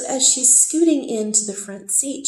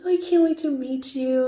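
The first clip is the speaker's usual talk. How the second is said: higher, sweet and eager; sweet girlish voice; 6 semitones higher, 4 dB louder than usual. Retold or imitated. Girlfriend telling the narrator she can't wait to meet her, sweetly. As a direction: gush it sweetly. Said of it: "she's really sweet".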